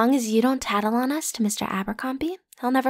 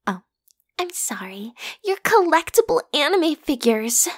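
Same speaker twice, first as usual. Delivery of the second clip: mock whispered apology; 4 semitones higher than usual. Mocking listener's posters.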